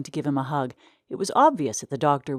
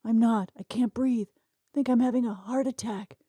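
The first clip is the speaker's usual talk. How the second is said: gasping; 7 semitones higher than usual. Acted. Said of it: "gasped Mister Windsor".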